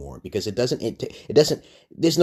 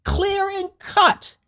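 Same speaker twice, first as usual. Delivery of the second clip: much higher, loud, ending slow and drawn out; much higher indignant exclamation, dragging the last words; retold outburst; 3 dB louder, slower than usual. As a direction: shout it like it's obvious, then spell it out slowly. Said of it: "I just said".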